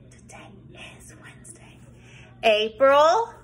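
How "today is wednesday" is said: soft unvoiced whisper. Shown as a demonstration. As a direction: whisper it like a secret. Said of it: "Let's say today is Wednesday".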